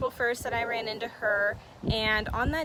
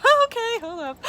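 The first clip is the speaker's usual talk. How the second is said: much higher and louder, a shocked double-take; startled thought; 13 semitones higher, 9 dB louder than usual. Retold or imitated. [name] replaying her shock at the price, loud startled squeak. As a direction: stop yourself short in disbelief. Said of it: "I'm thinking".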